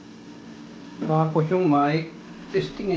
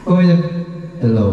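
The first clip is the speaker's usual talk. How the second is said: digital echo; 3 semitones higher, 8 dB louder, faster than usual. Real, not acted. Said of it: "May echo, mahal".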